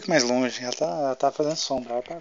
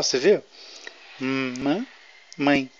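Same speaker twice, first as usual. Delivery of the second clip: ordinary talk, nothing marked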